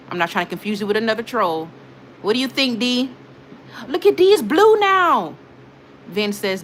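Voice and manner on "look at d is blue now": high, loud, delighted shout; excited exclamation. Real, not acted. Streamer shows off new mod's blue badge, excited shout. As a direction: show everyone, bursting with excitement.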